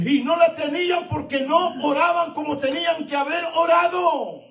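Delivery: high, loud, building sermon cry; full-force preaching. Real, not acted. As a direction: preach it hard to the pews. (predicación a todo pulmón, aguda y fuerte)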